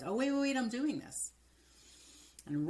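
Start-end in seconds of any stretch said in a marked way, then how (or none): 0.0-1.3 higher and louder, caught in the act; a startled oh, wait; sudden realization. Retold or imitated. Coach retelling catching herself rushing, surprised and loud. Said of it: "and then I realize".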